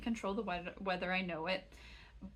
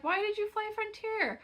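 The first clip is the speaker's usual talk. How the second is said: pitched way up, mocking disbelief; mock incredulous viewer; 12 semitones higher than usual. Mimicking viewers who ask why she flew Frontier, mock incredulous high voice. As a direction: squeak it like a skeptical viewer. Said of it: "And you're like".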